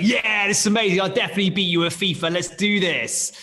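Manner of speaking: boisterous mock enthusiasm; laddie guys. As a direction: imitate your mates bragging, all fired up. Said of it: "the laddie guys, they're like".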